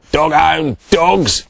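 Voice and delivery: slow, loud grumble; grumbled. A narrator's reading. Bungo complaining about the deserting dogs, grumbled. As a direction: grumble it slowly. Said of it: "grumbled Bungo".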